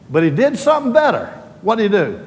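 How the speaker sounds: rising, soaring preacher's cry; preaching at full force. Acted. Preaching climax.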